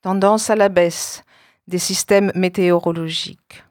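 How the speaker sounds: ordinary talk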